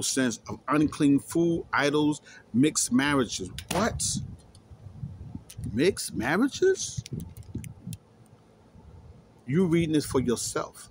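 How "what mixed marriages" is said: high, slow disbelieving cry; as if he can't believe what he just read; outraged disbelief, drawn out high; incredulous. Real, not acted. Shocked reading of the definition.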